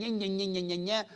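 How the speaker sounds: whiny nonsense mimicry of griping; mock complaining. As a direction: imitate someone moaning on and on. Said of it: "bitching and moaning and complaining".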